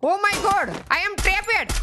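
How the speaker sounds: high alarmed cry of being trapped; excited. Real, not acted. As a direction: yelp it like you're cornered. Cornered by enemies in game.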